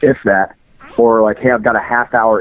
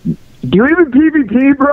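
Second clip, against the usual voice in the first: gamer bro voice. Acted. Caller's mock gamer-bro catchphrase.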